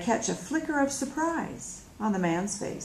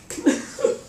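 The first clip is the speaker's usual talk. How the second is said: short laugh; 3 dB louder than usual. Acted. Laughter after the joke.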